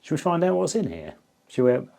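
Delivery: usual speaking voice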